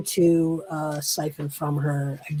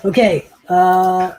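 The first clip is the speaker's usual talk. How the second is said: ordinary talk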